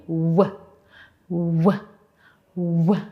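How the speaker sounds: "W" said as a short "wuh"; W sound. Shown as a demonstration. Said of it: "A letter W makes the W sound".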